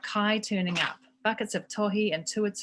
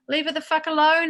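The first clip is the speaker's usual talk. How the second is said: loud angry yell at the dogs; 10 semitones higher, 10 dB louder, faster than usual. Acted. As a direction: bellow it at the dogs. Yelling at the dogs.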